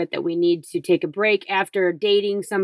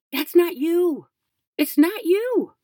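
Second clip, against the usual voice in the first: high emphatic; 12 semitones higher than usual. Real, not acted. Emphatic reveal, pitch jumps.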